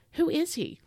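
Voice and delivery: normal talking voice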